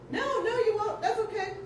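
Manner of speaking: high mock voice of Uncle Wayne; lightly voiced uncle brushing it off; quoting Uncle Wayne. Uncle brushing off the handcuff dare, high mock voice.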